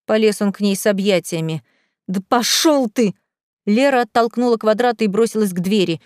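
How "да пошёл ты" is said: slow, drawn-out brush-off; angry dismissal. A narrator's reading. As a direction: send him packing, stretching the words. Spurned lover pushes him away. (злое «отвали» врастяжку)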